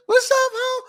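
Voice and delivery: put-on squealed very high, like a girl spotting her friend; girls greeting each other. Imitating girls greeting each other in a very high squeal. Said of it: "A lot of girls talk like this".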